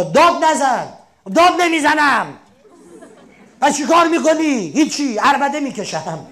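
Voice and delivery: loud, high mock yelling; mock shouting match. Two people quarrelling about shouting, loud playful yells. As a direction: ham up a shouting row.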